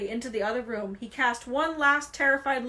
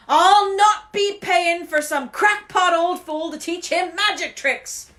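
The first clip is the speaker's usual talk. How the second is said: high, slow furious shout; loud indignant yell; 5 semitones higher, 9 dB louder, slower than usual. A narrator's reading. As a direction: bellow it in outrage. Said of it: "yelled Uncle Vernon".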